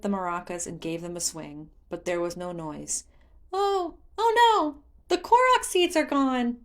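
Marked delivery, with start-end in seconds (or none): 3.5-6.6 high, loud panicked spirit voice; a child finding a broken toy; dismayed Korok. Acted. Hestu finds maracas silent.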